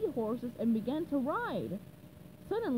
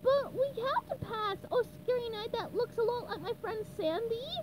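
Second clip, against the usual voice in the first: high, eager cartoon sponge hero; SpongeBob voice; 10 semitones higher than usual. SpongeBob pleading with the Dark Knight, high and eager. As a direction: pitch way up, earnest and brave.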